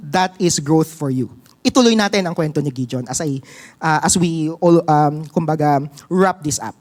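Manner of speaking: ordinary talk, nothing marked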